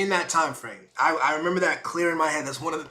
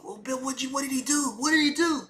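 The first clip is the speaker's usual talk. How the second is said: bystanders asking; 10 semitones higher than usual. Retold or imitated.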